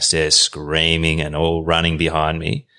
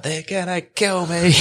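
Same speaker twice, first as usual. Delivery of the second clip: high, drawn-out panicked plea; retold terror in a much higher, stretched voice; mock panic; 11 semitones higher, slower than usual. Retells begging scooter riders for rescue.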